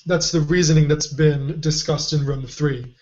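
ordinary delivery

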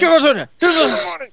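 high, loud holdup yell; robber's shouted demand. Acted. Robber ordering the bank staff, shouted demand.

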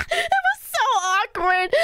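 high, just out of a laugh; laughing embarrassment. Real, not acted. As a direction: squeeze it out while cracking up. Cracking up at awkward memory.

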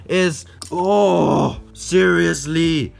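loud, high, drawn-out stressed moan. Real, not acted. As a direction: wail it from sheer stress. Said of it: "really in stress right now".

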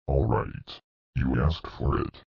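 hushed, ominous warning; quiet threat. Acted.

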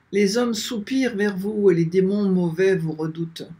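ordinary delivery

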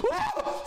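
very high startled shriek; frightened scream. Real, not acted. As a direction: scream at a jump scare. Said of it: "That actually scared me".

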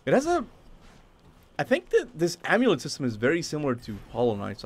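ordinary talk, nothing marked

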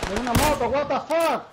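loud alarmed callout; excited shout. Real, not acted. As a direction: yell the warning. Enemy moto arriving in game.

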